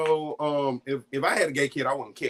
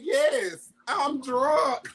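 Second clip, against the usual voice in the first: high-pitched imitation of drunken crying; mock crying; 12 semitones higher, much slower than usual. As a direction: wail it as a hammed-up tearful drunk. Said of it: "I'm crying".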